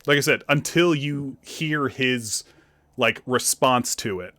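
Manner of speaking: ordinary talk, nothing marked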